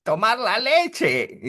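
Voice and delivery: put-on high, slow, loud baby wail. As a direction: cry for milk like a newborn. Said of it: "que decimos". (voz aguda de bebé pidiendo leche)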